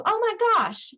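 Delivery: high, bright burst of joy; excited. Shown as a demonstration. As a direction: gush with sudden delight. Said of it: "you get excited too".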